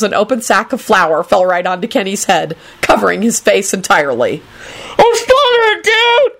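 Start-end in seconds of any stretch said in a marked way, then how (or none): 5.0-6.3 very high, strangled screech; panicked scream. A narrator's reading. Kenny covered in flour, screaming in panic. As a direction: scream as if smothering. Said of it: "he screamed".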